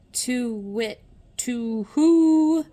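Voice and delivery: to wit rising to a loud who; owl hoot. A narrator's reading. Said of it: "hooted the owl".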